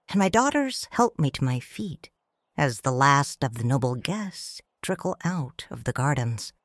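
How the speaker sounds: usual speaking voice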